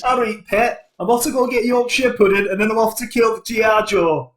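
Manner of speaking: hammed-up Geordie hero; cheery Geordie caricature heading off on a mission; mock Geordie accent. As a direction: put on a broad Geordie accent. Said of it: "Real North English hero".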